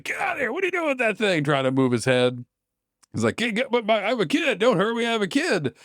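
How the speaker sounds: high, panicked begging; frightened father pleading with the monster; terrified pleading. Acted. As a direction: beg for your life. Dad pleading in terror.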